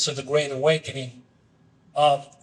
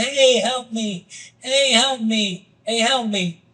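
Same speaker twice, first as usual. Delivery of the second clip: drawn-out, higher call without real volume; mock yell for help; 5 semitones higher, slower than usual. Trapped man calls to a passerby from a ditch, mock yell. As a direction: call out slowly, stretching each plea. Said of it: "he's yelling".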